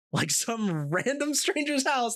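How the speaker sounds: high laughing disbelief at the story. Real, not acted. Friend laughing while telling the prank story. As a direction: laugh through it, pitch jumping up.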